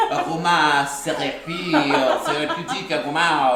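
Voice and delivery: playful imitation of a French chemist saying aroma thérapie; mock French accent. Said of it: "I wonder what it sounded like".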